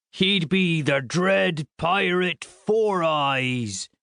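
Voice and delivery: high, very slow pirate taunt; mocking pirate proclamation. A narrator's reading. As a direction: drag out each word like a hammed-up pirate. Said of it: "we were back to the pirate voice".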